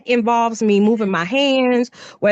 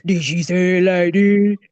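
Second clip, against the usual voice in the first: acting out a voice; 4 semitones lower than usual. Daughter trying another voice for mom's retelling, low put-on voice. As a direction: lower put-on voice. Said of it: "It had to be a voice".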